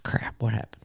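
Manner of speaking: whispered, alarmed question; startled under the breath; hushed alarm. Retold or imitated. Father hearing his son scream, whispering in alarm.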